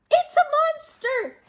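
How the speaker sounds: very high, loud scream for help; terrified scream. A narrator's reading. Dragon screaming for help in the dark woods. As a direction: shriek in pure panic. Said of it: "he screamed".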